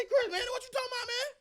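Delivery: high, fast, incredulous voice of the critics; mocking doubters. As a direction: mimic the fans scoffing at him. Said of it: "They say".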